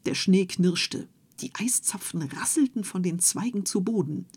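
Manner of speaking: plain reading voice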